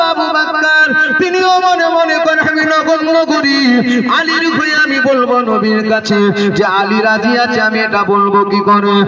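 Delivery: sing-song preaching build; preacher rising into chant. Acted. Preacher voicing Prophet, raised.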